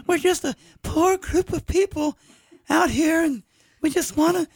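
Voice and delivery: very high squeaky pleading voice; Tusken Raider voice. Pretend.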